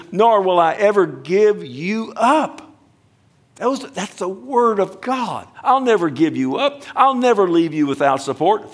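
high and loud, proclaiming the promise; soaring emphatic preaching; rising preacher's cry. Acted. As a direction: lift it up and ring it out over the church.

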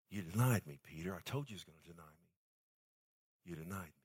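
soft, low, quiet reproach; a gentle wounded rebuke, barely above a murmur; lightly voiced hurt Jesus. Jesus looking at Peter after the denial, quiet and hurt.

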